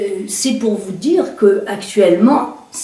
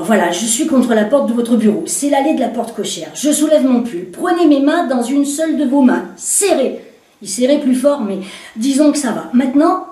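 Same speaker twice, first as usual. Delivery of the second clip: higher voice giving brisk orders; stage the scene for him, insistent; woman directing the commissaire's reenactment; commanding woman; 4 semitones higher than usual. Acted.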